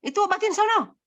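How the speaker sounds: high, dismissive sibling jeer; kakak mengejek. Retold or imitated. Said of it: "kakaknya yang mengejek gitu kan". (ejekan kakak bernada tinggi)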